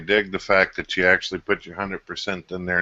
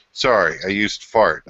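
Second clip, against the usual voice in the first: normal talking voice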